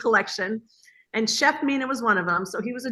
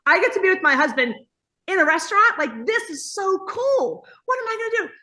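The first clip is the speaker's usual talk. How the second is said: higher, louder gushing excitement; thrilled; 8 semitones higher, 3 dB louder than usual. Retold or imitated. Wife thrilled to work with husband, higher gushing delight. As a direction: gush like you can't believe your luck. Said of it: "I was like".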